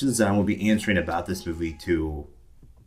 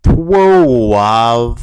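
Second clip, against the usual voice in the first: much louder, drawn-out bark; loud movie line; 10 dB louder than usual. Retold or imitated. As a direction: belt the word out. Movie quote impressions opening vlog.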